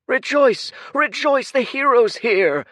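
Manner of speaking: very high, loud rejoicing shout; jubilant cry. Acted. Crowd member hailing the hero, very high and loud. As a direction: hail the hero to everyone.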